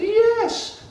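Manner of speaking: very high, loud chirpy yes; Ding Ding keen to help, chirpy; eager yes. Acted. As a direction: pop it out with delight. Ding Ding answers ant.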